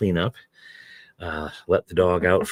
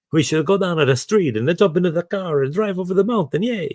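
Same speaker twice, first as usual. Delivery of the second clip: much higher, hammed-up Italian accent; 12 semitones higher than usual. Reader correcting course for Machiavelli's dialogue, in a hammed-up high Italian accent. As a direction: redo the line as Machiavelli, with an over-the-top Italian lilt. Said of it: "see the word Machiavelli coming".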